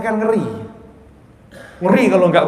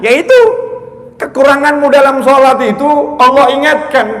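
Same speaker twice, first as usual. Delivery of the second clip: raised-voice, emphatic preaching; ceramah berapi-api; 8 semitones higher, 7 dB louder than usual. Acted. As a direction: press the lesson on the listeners, loudly. (khotbah tegas dengan suara lantang)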